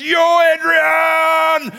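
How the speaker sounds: high, huge, drawn-out victory yell; Rocky. Retold or imitated. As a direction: bellow the name slowly in triumph. Acts out Rocky's victory call.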